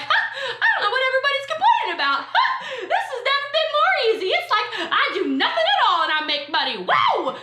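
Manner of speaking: high, fast, laughing carefree voice; mock laughing easy-money boss; smug breezy boss. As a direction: giggle it like business is effortless. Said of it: "You're like".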